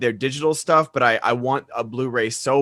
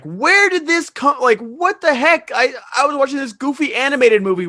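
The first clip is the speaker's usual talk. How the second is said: mock weeping; 10 semitones higher, 4 dB louder than usual. Viewer gut-punched by a goofy animated movie, mock weeping. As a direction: wail the questions as if weeping, hammed-up. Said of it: "Why am I weeping".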